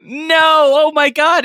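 mock horror. Sister horrified.

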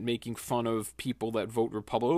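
normal talking voice

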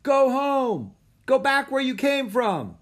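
high, loud jeering shout; heckling. A narrator's reading. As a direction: heckle from the stands. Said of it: "turned to heckling and booing".

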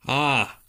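big high open-mouthed yawn; loud yawn. Real, not acted. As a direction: let a real late-night yawn out.

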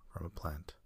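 ordinary talk, nothing marked

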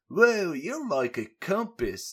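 put-on high, loud botched British accent that drifts Australian; failed Australian. [name] marvels at the floating scarab in a bad accent. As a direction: try for British, land in Australia. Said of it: "That was Australian".

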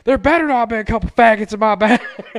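hammed-up outrage at the house owner; mock angry buddy.

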